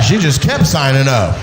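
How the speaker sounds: ordinary delivery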